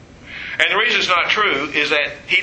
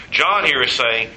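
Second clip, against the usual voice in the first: ordinary talk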